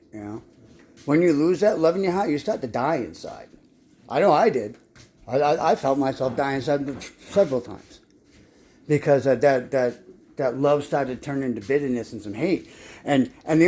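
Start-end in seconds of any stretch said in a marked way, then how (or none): none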